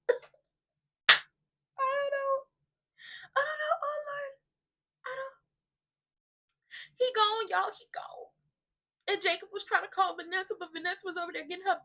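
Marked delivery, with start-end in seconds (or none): 0.1-7.7 high-pitched fake sobbing; mock crying. Mock grief wail. 7.8-8.3 unvoiced whispered lament; mock grief whisper. Breaks into whisper.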